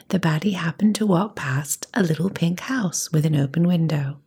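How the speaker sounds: ordinary delivery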